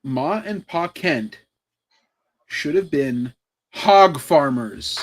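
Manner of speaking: slow, drawn-out delivery with a pause before the punchline; slow deliberate reveal. Shown as a demonstration. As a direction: savour each word like a grand pronouncement. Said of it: "You wanna hear it?".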